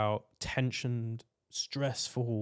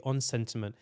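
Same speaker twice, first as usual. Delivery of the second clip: ordinary delivery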